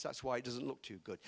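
ordinary talk

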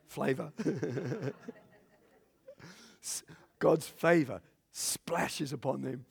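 usual speaking voice

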